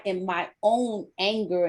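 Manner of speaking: ordinary talk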